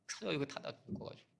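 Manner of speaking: whispered. Real, not acted. Sad aside about dead boy.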